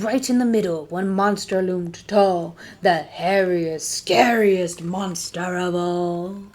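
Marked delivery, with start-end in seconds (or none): none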